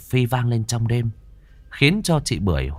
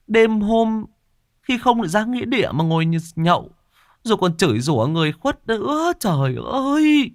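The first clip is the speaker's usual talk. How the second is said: alarmed scolding; 9 semitones higher than usual. Acted. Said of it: "hốt hoảng".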